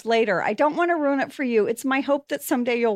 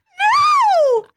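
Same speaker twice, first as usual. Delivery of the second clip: high shrieking shout of no; shocked shout; 5 dB louder than usual. Real, not acted. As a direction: scream it in disbelief. Shocked outburst.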